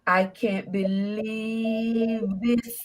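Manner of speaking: slow, drawn-out imitation wail; long moaning cry, acted out in retelling; mock bawling. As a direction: imitate a man bawling, stretching every word. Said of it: "And he started bawling".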